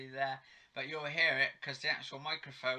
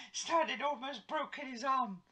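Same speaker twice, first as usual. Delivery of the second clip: excited; 11 semitones higher than usual. Real, not acted. Excited retelling of a soap scene.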